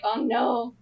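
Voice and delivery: ordinary delivery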